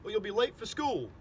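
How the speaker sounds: high, loud wake-up call; exasperated parent calling out; father's scolding. A narrator's reading. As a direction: rouse a lazy child, loud and sharp. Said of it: "my father's real voice saying".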